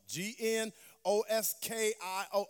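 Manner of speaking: ordinary talk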